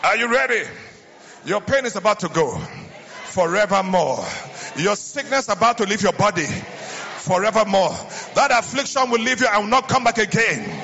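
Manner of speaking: loud, high, driving preacher cadence; revival preaching. Acted. Preacher rousing the congregation before the jump, loud and high. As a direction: whip the crowd up, chanting it out.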